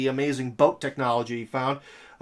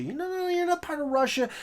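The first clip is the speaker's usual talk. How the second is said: high, cooing no no no, much higher; mock sweet foreign flatterer; 14 semitones higher, 4 dB quieter, faster than usual. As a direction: coax like a flattering foreign power. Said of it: "by foreign powers that".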